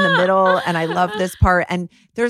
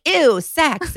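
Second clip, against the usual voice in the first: grossed-out squeal; 9 semitones higher, 4 dB louder than usual. Retold or imitated. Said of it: "She would always talk on the air about".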